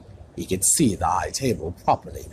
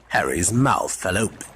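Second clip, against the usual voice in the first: plain reading voice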